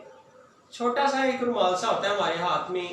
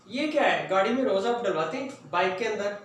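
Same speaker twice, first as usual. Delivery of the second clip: ordinary delivery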